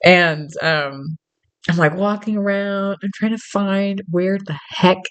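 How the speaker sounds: ordinary delivery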